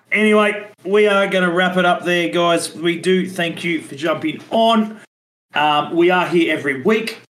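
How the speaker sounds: upbeat host sign-off voice; podcast outro. Acted. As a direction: wrap up the show like a host.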